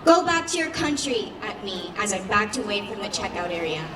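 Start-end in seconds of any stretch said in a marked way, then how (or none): 0.0-1.3 high-pitched, mildly raised shout; narrated jeer, pitched well up; continued shouting. A narrator's reading. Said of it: "he kept shouting".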